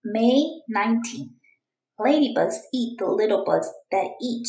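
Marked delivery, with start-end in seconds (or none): none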